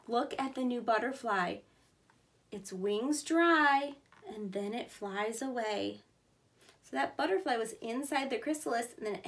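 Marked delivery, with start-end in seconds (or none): 2.5-6.0 slow emphasis. Acted. Reading to children.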